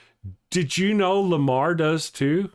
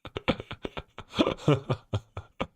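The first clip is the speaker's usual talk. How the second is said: long, soft, breathy laugh; laughter; 5 dB quieter than usual. Real, not acted. Host reacting to an absurd chat message, laughing. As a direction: laugh helplessly in quiet bursts.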